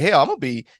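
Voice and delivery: quick, laughing mock outrage; half-laughing as he remembers thinking it; laughing disbelief. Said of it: "I was like".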